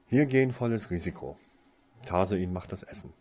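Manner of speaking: loud, rousing announcement; bold declaration. A narrator's reading. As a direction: declare it like a daring plan.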